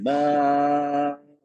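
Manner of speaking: बा held very long on a level pitch; stretched at will. Shown as a demonstration. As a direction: keep stretching बा on one open breath. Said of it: "कितना खींचना चाहे खींच सकते हैं". (बा को जितना चाहें खींचें)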